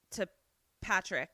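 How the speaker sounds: usual speaking voice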